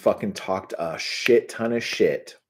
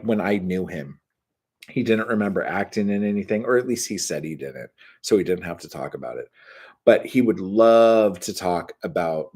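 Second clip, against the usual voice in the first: normal talking voice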